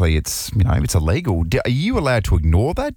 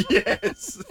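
laughing; 5 dB quieter than usual. Real, not acted. Host cracks up at caller.

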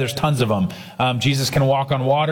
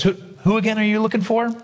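teasing question; 7 semitones higher, faster than usual. Acted. Jesus teasing the fallen soldiers.

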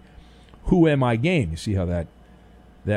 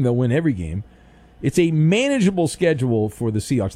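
ordinary talk, nothing marked

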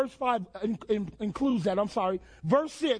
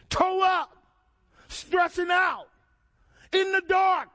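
preaching shout; 6 semitones higher, 3 dB louder, slower than usual. Real, not acted. Preacher builds a climax.

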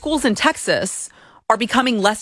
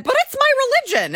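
much higher, fast whiny plea; mock pleading; faster than usual. Host mocking students' claim to Stephen, whiny plea. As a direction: mimic a pleading religious excuse. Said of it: "maybe they said".

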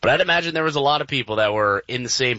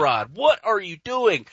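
exasperated; 8 semitones higher than usual. Retold or imitated. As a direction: drag out the disbelief. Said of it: "It's like".

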